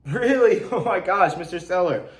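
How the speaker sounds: bright teasing exclamation with a laugh; playful tone. Shown as a demonstration. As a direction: tease the seller playfully. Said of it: "or a playful tone".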